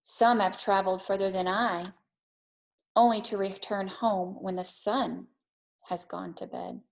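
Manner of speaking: put-on gentle read-aloud for children; calm storytelling pace for a classroom; storybook reading. A narrator's reading. Teacher reads picture book aloud.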